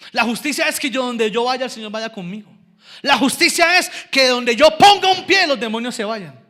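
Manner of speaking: loud declaration building to a high peak; triumphant preaching. Real, not acted. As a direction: proclaim it with full pulpit force. Preaches to a climax.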